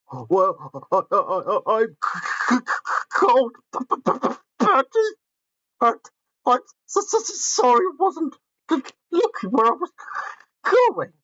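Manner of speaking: high, stuttering teeth-chattering voice; shivering. Acted. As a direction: stammer out through chattering teeth. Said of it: "shivered Gordon".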